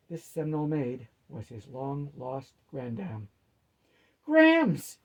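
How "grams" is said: put-on boyish cry of joy, much higher and louder; gushing. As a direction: call out like a delighted boy. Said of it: "he gushed like the boy".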